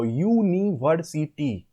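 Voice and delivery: "university" stretched out slowly from a "yoo" onset; starting with y-oo. Shown as a demonstration. Teacher explaining the vowel sound, "university" slowly begun with "yoo". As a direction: draw out "university", opening on a consonant y sound. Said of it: "we speak as Y double O".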